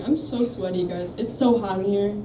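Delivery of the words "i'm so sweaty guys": ordinary talk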